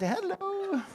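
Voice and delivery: high, bright sing-song hello with a laugh; a chirpy, laughing hello from the cleaner; cheerful cleaning lady. Retold or imitated. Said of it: "and said".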